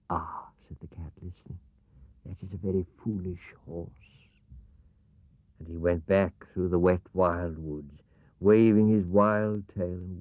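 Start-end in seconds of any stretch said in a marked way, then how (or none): 0.1-4.3 whisper. Acted. Cat listening in hiding.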